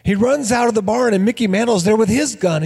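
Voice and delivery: usual speaking voice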